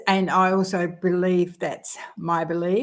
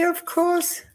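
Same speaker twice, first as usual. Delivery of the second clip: sing-song; 11 semitones higher than usual. Real, not acted. Playful aside.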